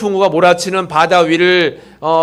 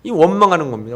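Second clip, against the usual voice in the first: ordinary talk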